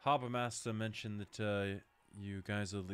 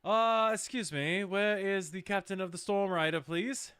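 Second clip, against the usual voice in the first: yelling out; 11 semitones higher, 8 dB louder than usual. Acted. Player calling to the room for the captain, loud and high. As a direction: call out to the whole room. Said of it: "as you yell this out".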